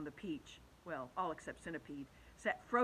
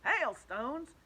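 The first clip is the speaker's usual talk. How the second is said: incredulous, louder query; scornful disbelief; 7 semitones higher, 7 dB louder than usual. Acted. Centipede doubting James, incredulous. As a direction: scoff at the very idea. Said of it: "the centipede said".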